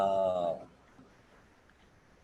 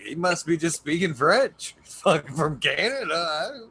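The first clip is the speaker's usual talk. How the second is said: higher, joking voice; mock gullible friend; 7 semitones higher, 4 dB louder than usual. Friends teasing a stoned buddy, higher joking voice. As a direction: tease him as if fooled. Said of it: "We'd be like".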